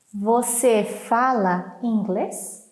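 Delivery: slow, word-by-word question; slow model reading. Shown as a demonstration. Teacher repeats the dialogue question for learners, slowly and clearly.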